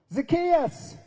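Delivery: high, loud call up into a tree; yelled name. Acted. Jesus calls up to Zacchaeus in the tree, loud shout. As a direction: shout his name so the whole crowd turns. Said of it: "He yelled it".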